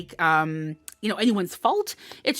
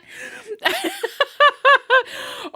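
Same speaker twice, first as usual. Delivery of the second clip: real laughter, much higher and louder; 6 dB louder than usual. Real, not acted. Reader laughs at Christina's comment in live chat, loud high laugh. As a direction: burst out laughing.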